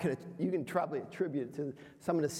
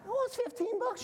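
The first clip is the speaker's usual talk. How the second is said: high, laughing disbelief; laughing retort. Retold or imitated. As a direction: laugh it off to the wife. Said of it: "I said".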